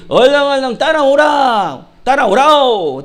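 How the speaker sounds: loud, stretched Pyongan dialect herding call. Acted. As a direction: holler to the sheep in a drawn-out Pyongan accent. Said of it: "목자가 가면요". (크고 길게 뽑는 평안도 사투리)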